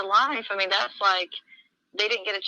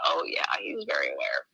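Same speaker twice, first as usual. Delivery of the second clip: much lower, dry mock agreement; sarcastic echo; 4 dB quieter than usual. Echoing the man about the Lord, sarcastic. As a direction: drip sarcasm.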